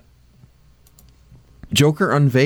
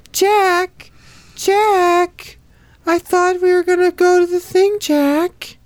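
put-on high nasal whiny girlfriend voice; brassy gum smacking floozy; much slower than usual. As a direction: whine his name like a floozy.

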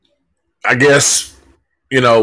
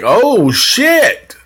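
high startled "oh, shit"; retold shock; 11 semitones higher than usual. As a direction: jump up in pitch, caught off guard. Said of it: "I was like".

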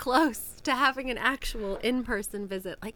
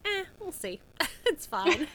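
imitating friend; 8 semitones higher, 5 dB louder than usual. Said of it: "you were like".

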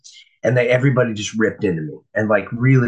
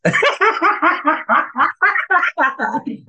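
big laugh. Real, not acted. Recalling the staff's bets on him, big laugh. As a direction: laugh out hard at the memory.

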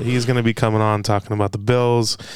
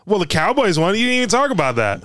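much higher, playful jab; excited teasing; 7 semitones higher than usual. Real, not acted. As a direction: rib him about the win. Teasing friend about Cowboys.

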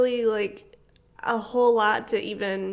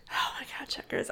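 whispering; 3 semitones higher, 10 dB quieter than usual. Real, not acted. Whispered craving.

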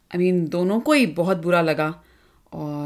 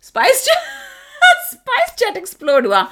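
laughing disbelief; 13 semitones higher, 6 dB louder than usual. Real, not acted. Partner's twist makes her burst out.

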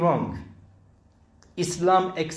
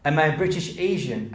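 usual speaking voice

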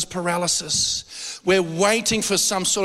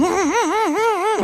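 high laugh; 13 semitones higher than usual. Real, not acted. Laughing at dog story.

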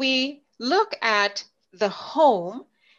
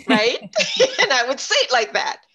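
laughing; 4 semitones higher, 6 dB louder than usual. Real, not acted. Laughing at own story.

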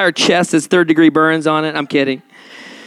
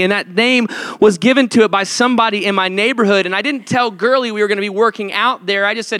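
ordinary talk, nothing marked

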